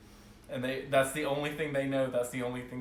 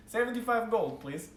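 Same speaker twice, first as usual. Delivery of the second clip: higher, cheerful price called out; dwarf shopkeeper; 9 semitones higher than usual. Acted. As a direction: merchant naming his price.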